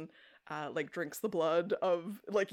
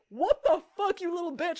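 higher and louder, spitting fury at a traitor; furious yell; 11 semitones higher, 6 dB louder than usual. Retold or imitated. Rose lashing out at Trevor, high furious yell. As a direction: snarl it like you've been betrayed.

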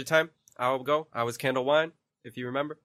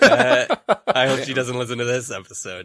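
laughing; 6 dB louder than usual. Real, not acted. Joking about girlfriend, laughing.

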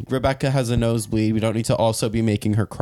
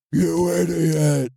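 higher, drawn-out mock outburst; hammed-up scolding, pitched up; mock yelling insult; 6 semitones higher, much slower than usual. Shown as a demonstration. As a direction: overdo an insulting telling-off, slowly. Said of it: "raising your voice and being stern and then being like".

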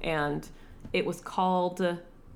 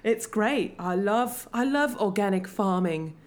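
put-on British accent, slightly raised. Podcaster mocks the miscast love interest, in a British accent. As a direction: parody an actor's straight-up British accent. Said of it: "just has a straight-up British accent".

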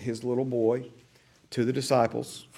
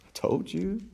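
higher, taunting "I told you"; taunting devil; 8 semitones higher, 4 dB quieter than usual. Acted. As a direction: gloat like a devil on the shoulder. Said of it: "the devil gets on your shoulder and he says".

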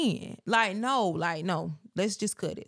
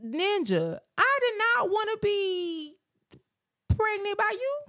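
indignant outburst; 12 semitones higher than usual. Real, not acted. Arguing with partner about pregnancy.

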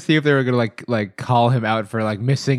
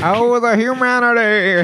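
put-on old newsreel lament; 8 semitones higher, slower than usual.

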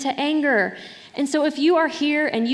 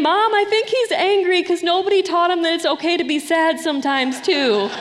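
put-on a light child's voice, higher and brighter in pitch; voicing young daughter; 6 semitones higher, 3 dB louder than usual. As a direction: play the little girl explaining it to mom. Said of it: "my daughter saying".

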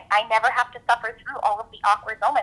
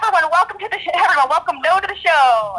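high, loud showy welcome; stage performer's greeting; 10 semitones higher, 5 dB louder than usual. Acted. Performer welcoming a volunteer named No to the show, showy stage greeting. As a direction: belt out a high-pitched host's welcome. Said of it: "Marina would respond with".

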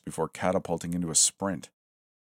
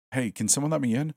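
higher and fast, urgent; panicked plea; 8 semitones higher, faster than usual. Acted. Smith banging on the dorm door, panicked and fast. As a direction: beg to be let in, fast.

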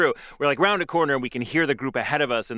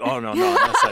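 laughing reassurance; 4 dB louder than usual. Retold or imitated. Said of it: "we're like".

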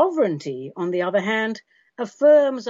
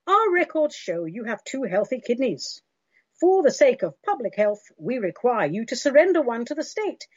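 high, brisk official recorded voice; dystopian announcer; 4 semitones higher than usual. Pretend. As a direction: read it like a cold state robocall. Mock state robocall message.

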